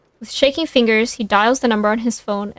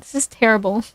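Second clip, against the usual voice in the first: ordinary delivery, nothing marked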